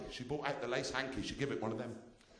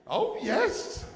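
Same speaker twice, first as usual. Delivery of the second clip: high, louder posh "Oh, yes"; mock posh person; 6 dB louder than usual. Comic teasing a front-row posh person, mock posh. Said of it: "you're a posh person".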